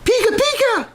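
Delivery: squeaky, very high cartoon creature cry; high, squealing Pika call; Pikachu voice. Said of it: "is he gonna run around going".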